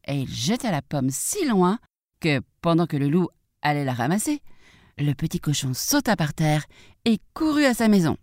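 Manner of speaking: usual speaking voice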